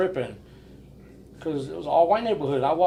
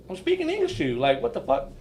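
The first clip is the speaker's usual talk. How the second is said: ordinary talk, nothing marked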